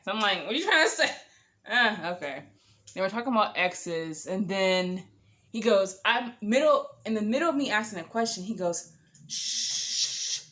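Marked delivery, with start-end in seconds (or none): 0.4-1.1 much higher, louder what are you trying to say; indignant surprise. Retold or imitated. Reacting to his innuendo. 9.3-10.4 voiceless sharp shh; shushing. Retold or imitated.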